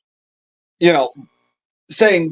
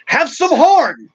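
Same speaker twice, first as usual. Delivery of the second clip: mock rhino; 6 semitones higher than usual. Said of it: "that rhino is gonna turn around and be like".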